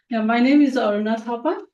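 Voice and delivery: normal talking voice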